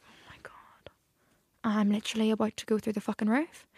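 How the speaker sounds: whispered fury, held under the breath; hushed, barely contained exasperation; seething whisper. Retold or imitated. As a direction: hiss your rage so nobody hears. Suppressed rage in a shop.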